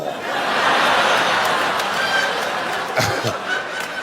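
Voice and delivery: long breathless high-pitched laugh. Real, not acted. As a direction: let the laugh run out high and airless.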